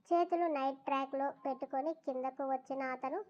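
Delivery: ordinary delivery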